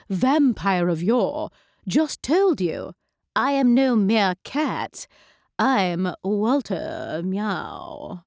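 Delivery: put-on slow, formal old-world gentleman cat; pompous cat voice. The cat Walter formally introduces himself to Mandy, slow and grand. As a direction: announce yourself like an aristocratic cat. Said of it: "Walter meowed like".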